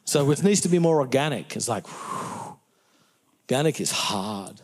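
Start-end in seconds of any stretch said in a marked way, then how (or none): 1.9-2.6 long voiceless blown-out breath; soft, drawn-out exhale; whew. Acted. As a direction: blow out air at the daunting effort. Reacts to how hard organic is.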